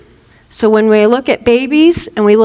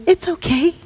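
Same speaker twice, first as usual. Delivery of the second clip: put-on softer and higher, gently reassuring a fussing baby; soothing; 7 semitones higher, 4 dB quieter than usual. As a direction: coo it to a baby with tummy trouble. Comforting a baby.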